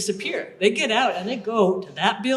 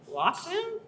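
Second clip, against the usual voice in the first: surprised; 10 semitones higher, 7 dB quieter than usual. Retold or imitated. Confused recruit hearing about lockdown.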